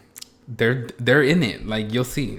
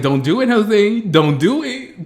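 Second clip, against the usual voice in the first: mock dog warning; 9 semitones higher, 7 dB louder than usual. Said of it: "Is that dog trying to tell me".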